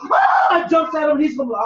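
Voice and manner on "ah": loud high piercing "Ah!"; scream. Shown as a demonstration. Woman screaming at an attacker to shock him. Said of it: "but if she screams".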